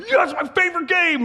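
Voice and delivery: put-on high, loud excited child shout; squealing kid delight. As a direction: cheer like a thrilled kid. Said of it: "Kids are like".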